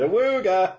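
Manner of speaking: goofy awooga horn; silly clown. Pretend. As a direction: honk it out like a clown. Mock car horn from Superman.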